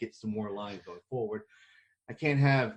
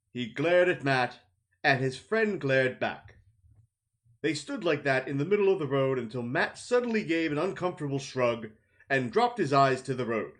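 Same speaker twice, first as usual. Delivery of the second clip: story narration; 3 semitones higher than usual. A narrator's reading. Narrator describing the standoff, steady storytelling. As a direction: set the scene evenly. Narrator passage between dialogue.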